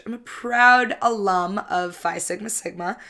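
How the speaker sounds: ordinary talk, nothing marked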